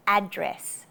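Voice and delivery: "address" as AD-dress, front-stressed; first-syllable stress. Shown as a demonstration. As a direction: put the weight on the "ad" of "address". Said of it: "emphasize the first syllable".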